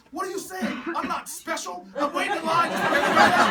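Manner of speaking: high, loud retort over the crowd; yelling back. Retold or imitated. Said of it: "I yell back at them".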